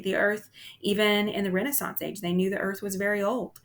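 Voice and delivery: ordinary talk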